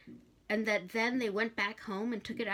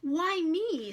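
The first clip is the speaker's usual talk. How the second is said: high, pleading cry of despair; desperate; 10 semitones higher, 5 dB louder than usual. Acted. Crowley pleading with Hastur, desperate. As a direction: beg to be spared the task. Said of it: "said Crowley desperately".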